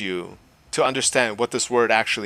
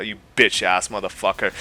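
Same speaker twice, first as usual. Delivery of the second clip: normal talking voice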